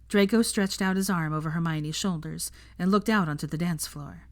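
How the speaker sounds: usual speaking voice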